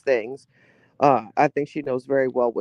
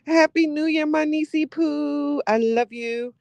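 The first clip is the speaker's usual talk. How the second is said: sweet greeting; 11 semitones higher than usual. Real, not acted. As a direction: coo it to your niece. Greeting niece on stream.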